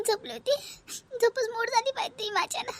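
mother half-sobbing down the phone; mild crying mother. Acted. Mother calling her daughter late at night, mildly tearful. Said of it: "तू रडून काहून राहिली?".

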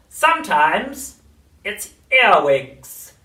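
high, slow crone voice; witch voice. A narrator's reading. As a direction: cackle it like an old witch.

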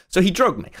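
normal talking voice

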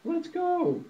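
very high honking goose voice; squeaky, high-pitched goose honk. Acted. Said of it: "honked Goosey Lucy".

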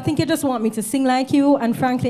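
loud, exasperated complaint; frustrated outburst. Acted. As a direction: push back hard in an argument. Quarrel with choir mate.

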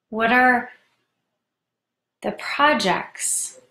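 measured meditation voice with long pauses; guided meditation. Real, not acted. Host guides listeners into tarantula meditation, unhurried guided-meditation voice. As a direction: guide them inward, leaving room to breathe.

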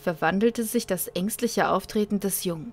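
dramatic narrator reading a sci-fi tale; story narration. A narrator's reading. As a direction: read it like an audiobook.

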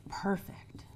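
breathy whisper of the one word; a soft whispered punchline; whispered aside. Real, not acted. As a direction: let it slip out hushed. Dropped word on the scale.

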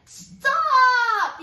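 big loud booming stop; gorilla's yell. A narrator's reading. Gorilla yelling at the fleeing mouse. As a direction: bellow it to halt her. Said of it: "yelled the gorilla".

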